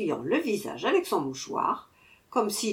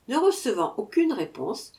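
ordinary delivery, nothing marked

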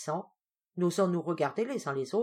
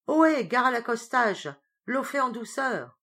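hailing call; 7 semitones higher, 6 dB louder than usual. Acted. Call from far above.

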